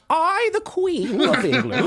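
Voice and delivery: high, slow royal proclamation; grand, drawn-out British monarch; Queen of England. Acted. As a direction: proclaim it ceremoniously, high-pitched. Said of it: "Back in character".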